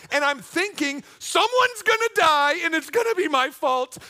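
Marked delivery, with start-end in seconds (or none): 1.2-4.0 high, loud inner scream; panicked outburst. Retold or imitated. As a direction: blurt it out in mock panic. Retold panicked inner thought.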